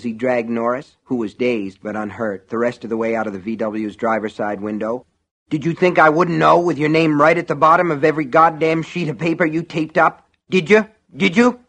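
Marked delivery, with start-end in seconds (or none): 5.5-11.6 high, loud ranting accusation; enraged, raised-voice demand; furious yell. Acted. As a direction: rant at full voice, rising on each question. Enraged man yelling accusations.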